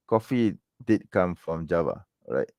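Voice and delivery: usual speaking voice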